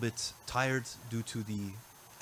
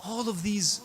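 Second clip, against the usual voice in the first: lamenting recitation; 5 semitones higher than usual. Acted. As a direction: draw the listeners into the mourning, unhurried. Shifts into mourning narration of Karbala.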